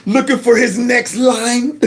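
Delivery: high, laughing through the words; mocking laughter while talking. Real, not acted. Laughing at the host.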